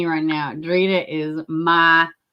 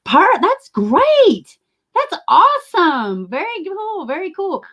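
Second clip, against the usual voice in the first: much higher, slowed, sing-song praise; hammed-up high gushing voice; mock gushing reply; 13 semitones higher, 3 dB louder, slower than usual. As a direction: parrot an overly sweet compliment.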